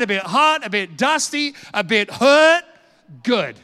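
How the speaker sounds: high, emphatic exhortation in rising bursts; rally the room, punching each item; forceful preaching. Real, not acted. Preacher rallying church for the Olympics of eternity, forceful.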